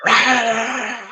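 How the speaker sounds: short high roar of rage; mock rage roar. Showing viewers the rage he avoids, a brief roar. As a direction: let out a quick angry rah. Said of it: "so that I'm not sitting here going".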